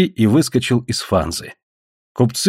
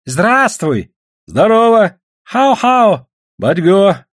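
high, raised greeting calls; hailing; 8 semitones higher, 4 dB louder, much slower than usual. A narrator's reading. Villagers greeting the Russian visitor, hailing. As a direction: call hellos across an open space.

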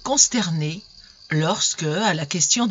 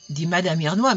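ordinary delivery

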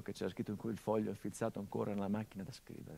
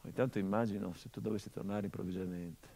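tender letter reading; 2 semitones lower than usual. Acted. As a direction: let each phrase hang in the silence. Reads father's letter over music.

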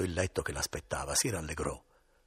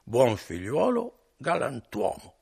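put-on higher, louder, very slow drunken echo; 7 semitones higher, 7 dB louder, slower than usual. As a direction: parrot the praise back, sozzled. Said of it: "rispose Renzo".

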